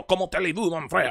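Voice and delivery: mock French. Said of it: "it sounds French".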